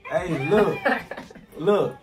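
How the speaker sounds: loud, laughing call; laughing shout. Pretend. Prank caller yelling, laughing.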